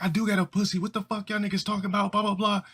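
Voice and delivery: high, fast put-on girl protest; the tomboy protests the boys' roasting; frustrated girl. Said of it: "She's like".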